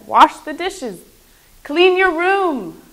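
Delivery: loud, commanding parent, pitch climbing; mother's firm order. Acted. Mother telling her child to do chores, firm order. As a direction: lay down orders loudly and firmly. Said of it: "No, she says".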